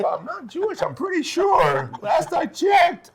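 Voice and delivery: mock defensive denial. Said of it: "I'm like".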